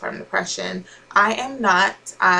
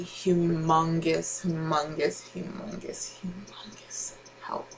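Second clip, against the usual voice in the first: each humongous softer until whispered; fading to a whisper; 7 dB quieter, slower than usual. Real, not acted. Mom praising her fiance, fading from speech to a whisper. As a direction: let the repeats die away into a whisper.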